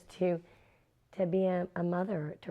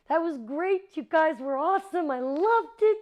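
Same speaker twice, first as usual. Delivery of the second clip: high, loud, delighted praise; gushing director; 12 semitones higher, 8 dB louder than usual. Retold or imitated. Director congratulating actors after the take, gushing loudly. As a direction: rave about it at full enthusiasm.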